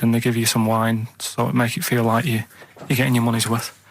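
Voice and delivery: ordinary talk, nothing marked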